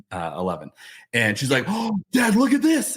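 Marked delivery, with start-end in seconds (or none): 1.7-3.0 high-pitched imitation of an excited child; excited daughter. Daughter shows dad her find in the shopping cart, imitated excitement. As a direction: mimic a kid thrilled by a big find. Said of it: "got, like, really excited".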